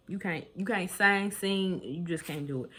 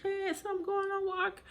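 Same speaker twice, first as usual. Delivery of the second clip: shocked disbelief; 10 semitones higher than usual. Retold or imitated. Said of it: "And I'm like".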